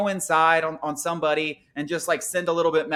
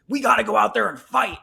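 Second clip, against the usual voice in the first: mock hothead; 4 semitones higher, 3 dB louder than usual. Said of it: "just hammering their fists like".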